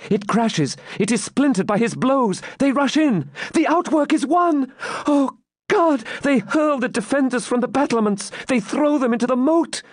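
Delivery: very high, mounting excitement; highly agitated maiden. Acted. Maiden sees outwork stormed, crying out. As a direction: build from excitement into horror. Maiden's climactic battle commentary.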